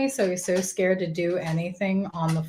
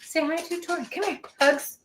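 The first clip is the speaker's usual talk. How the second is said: high sing-song coaxing to a pet; 10 semitones higher, 5 dB quieter than usual. Real, not acted. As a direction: coo at the dog to come over. Coaxing the dog to greet.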